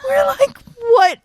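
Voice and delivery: very high, loud, words through laughing; hysterical laughter. Real, not acted. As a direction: gasp it out mid laughing fit.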